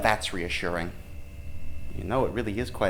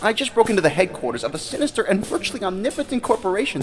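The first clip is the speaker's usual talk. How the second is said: indignant rant; 10 semitones higher, faster than usual. Acted. Indignant rant about break-in.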